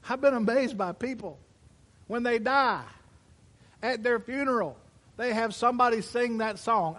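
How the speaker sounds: loud, high, driving sermon voice; full-force preaching. Acted. Pastor preaching hard to his congregation, loud and high. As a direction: preach it out to the whole room.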